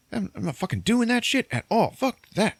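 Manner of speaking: hammed-up high-pitched refusal, fuming; high mock angry rant. Retelling Billy Bob's refusal to rob a charity, high mock angry rant.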